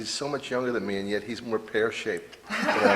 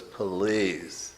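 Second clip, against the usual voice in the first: sassy flight attendant; 3 semitones lower than usual. Retold or imitated. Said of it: "hands me an extra large and goes".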